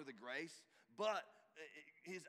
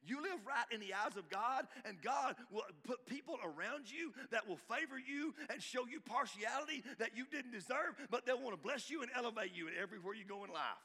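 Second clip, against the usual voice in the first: rising, louder preacher's call; full-force preaching; 7 semitones higher, 5 dB louder than usual. Acted. Pastor urging the congregation to live right, preaching hard. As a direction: preach it out, building conviction.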